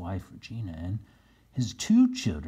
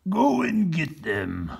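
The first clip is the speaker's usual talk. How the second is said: governor; 8 semitones higher, 5 dB louder, slower than usual. Acted. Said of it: "said the governor".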